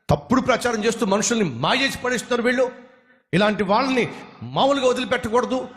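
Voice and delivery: high and fast, furious ranting; angry man ranting at the peacemaker; angry shouting. Acted. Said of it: "గట్టి గట్టిగా అరుస్తూ".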